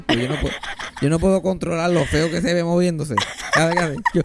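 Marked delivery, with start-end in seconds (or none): none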